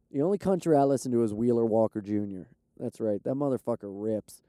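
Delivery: usual speaking voice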